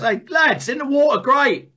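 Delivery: high, bright-eyed hail to his mates; high, loud, cheery fish calling to the others; cheerful fish. Acted. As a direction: sing out a chirpy greeting from the fish. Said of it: "another fish comes and he goes".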